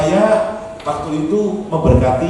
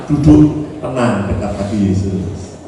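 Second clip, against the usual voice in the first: low, slow and gentle; slow right down into a peaceful low voice; calm, soothing; 11 semitones lower, slower than usual. Shown as a demonstration. Preacher quotes scripture to calm wife, calm.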